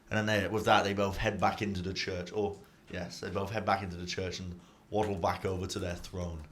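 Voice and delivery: ordinary delivery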